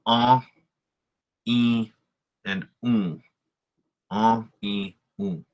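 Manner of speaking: en, i, un sent through the nose; nasal vowels. Shown as a demonstration. Teacher showing learners nasal vowels, sounded through the nose. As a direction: hum en, i, un nasally, each held alone. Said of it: "it's kind of more through your nose".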